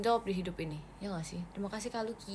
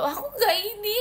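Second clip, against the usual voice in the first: much higher and louder, squeaked denial; squeaky denial; 12 semitones higher, 8 dB louder than usual. Real, not acted. To a friend asking about making up, squeaked denial. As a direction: squeak it out flustered.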